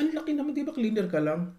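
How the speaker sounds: imitating a high, incredulous scoff; mock disbelief, pitched high; scoffing snob. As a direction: act shocked that a cleaner earns that much. Snob scoffs at cleaner's pay.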